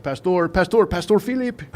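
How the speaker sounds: high-pitched mock Filipino accent. Inmates calling their visiting pastor, imitated Filipino accent. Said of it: "That's my Filipino accent, uh, impression".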